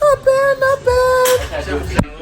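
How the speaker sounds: squeaky voice. Pretend. Squeaky high reaction.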